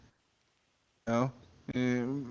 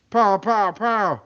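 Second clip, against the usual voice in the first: gunshots; 8 semitones higher, 5 dB louder, much slower than usual. Acted. Gunshots imitated.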